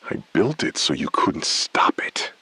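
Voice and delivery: a low bitter whisper. Acted. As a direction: hiss it back, barely voiced. Bitter hushed reply on phone.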